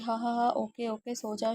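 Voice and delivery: usual speaking voice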